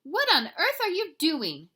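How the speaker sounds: high, loud mother's question; mother's scolding. A narrator's reading. Mama questioning her kitten, high and loud. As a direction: demand it like a cross mom.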